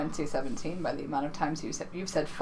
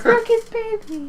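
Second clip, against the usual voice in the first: mock high voice; 14 semitones higher, slower than usual. Mocking a celebrity's baby.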